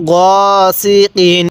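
গ held long and level, then সি কিন; recitation model. Shown as a demonstration. Teacher showing the learner how to read, drawn-out গ সি কিন. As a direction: draw out গ before measured সি কিন. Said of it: "কিভাবে পড়বেন".

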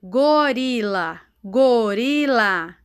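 very slow, long-held vowels; teacher sounding out the word at half speed; slow dictation. Shown as a demonstration. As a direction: draw out every syllable for young learners. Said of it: "igual a Pro está falando".

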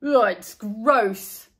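slow mock disgust; disgusted boy. A narrator's reading. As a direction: draw out the gross. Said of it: "said Buster".

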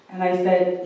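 ordinary delivery

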